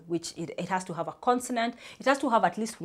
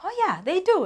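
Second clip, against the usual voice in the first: surprised realization; 13 semitones higher, 4 dB louder than usual. Retold or imitated. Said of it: "I'm like".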